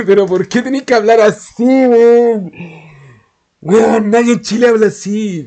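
loud, high-pitched mock outburst; exasperated retold complaint. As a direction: complain at full voice, as if fed up. Retelling his exasperated complaint to Maui.

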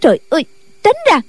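high rushed shout. A narrator's reading. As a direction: snap at him to move. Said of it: "quát lên".

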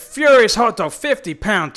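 loud gleeful callout; excited shout. Real, not acted. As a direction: yell it out in delight. Reading wild donation names aloud.

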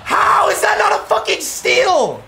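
high, loud yell of disbelief; furious shout. Real, not acted. Gamer protesting an uncalled steal, furious yelling. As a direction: explode over the bad call.

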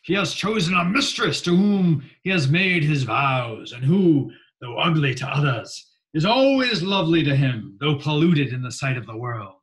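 loud, high Southern orator; theatrical Southern-accented speechifying. Retold or imitated. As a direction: declaim it like a Southern senator on the floor. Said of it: "had kind of a Southern accent".

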